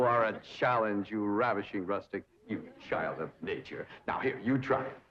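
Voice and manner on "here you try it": usual speaking voice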